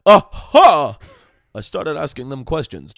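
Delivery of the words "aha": triumphant exclaim. Retold or imitated. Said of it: "I said".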